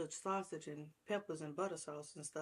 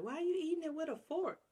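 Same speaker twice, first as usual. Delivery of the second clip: mock viewer question; 11 semitones higher than usual. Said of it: "y'all be like".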